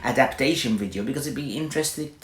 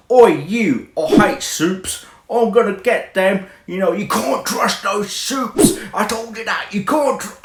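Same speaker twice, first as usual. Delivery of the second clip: loud, higher hammed-up British accent; mock British hard man, pitched up and loud; bad Bill Butcher British accent; 7 semitones higher, 7 dB louder than usual. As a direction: bark it like a rough British tough guy, badly faked British accent. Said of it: "okay, that's really a bad accent".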